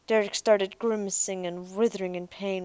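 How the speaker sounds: usual speaking voice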